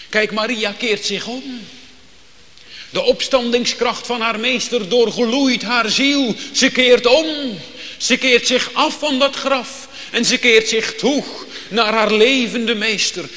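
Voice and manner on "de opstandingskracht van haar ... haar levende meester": luider en hoger, vol vuur verkondigd; vurig preken. Acted. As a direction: preek dit uit met volle overtuiging. Preacher rises to full force. (krachtig, luid en hoog gepreekt)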